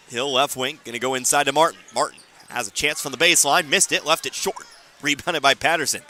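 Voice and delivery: ordinary delivery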